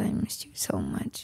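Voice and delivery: low whispered imitation. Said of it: "You said".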